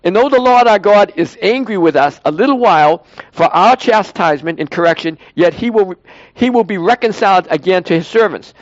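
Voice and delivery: solemn preached reading; scripture reading. Acted. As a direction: proclaim it like a martyr's defiance. Reads martyr's speech aloud.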